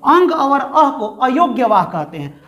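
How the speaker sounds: ordinary talk, nothing marked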